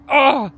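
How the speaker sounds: loud short gasp of relief; sharp cry of relief. Real, not acted. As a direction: let out a relieved ah. Relief while urinating.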